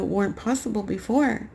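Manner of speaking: ordinary talk, nothing marked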